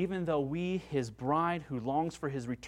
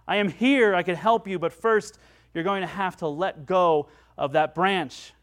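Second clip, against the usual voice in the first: booming voice; 7 dB louder than usual. Acted. Voice from above answers the fallen man, loud and booming. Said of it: "a voice boomed back and said".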